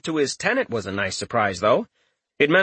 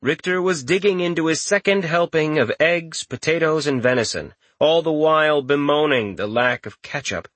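ordinary delivery, nothing marked

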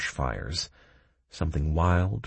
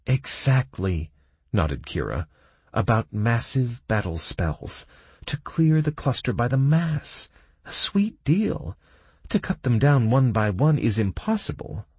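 higher, louder female voice; 7 semitones higher, 3 dB louder than usual. A narrator's reading. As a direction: pitch her up and bright. Kira explains.

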